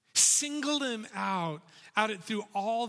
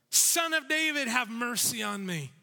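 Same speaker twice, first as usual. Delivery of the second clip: shouting for mercy; 5 semitones higher, 3 dB louder than usual. Acted. He shouted all the more.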